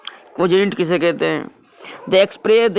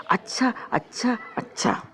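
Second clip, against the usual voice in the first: lightly voiced endless talker, softer; a hint of someone droning on; 3 semitones higher, 9 dB quieter than usual. Teacher imitating a person who talks on and on. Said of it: "जैसे".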